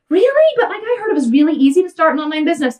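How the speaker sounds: squeaky, high, fast mock-naive voice; naive asker. Mimicking people who think business is easy, squeaky mock naivety. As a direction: play a clueless wannabe entrepreneur. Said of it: "And they're like".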